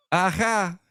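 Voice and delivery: high, triumphant movie-joke aha; punchline impression. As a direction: repeat the punchline in the high character voice. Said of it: "I said".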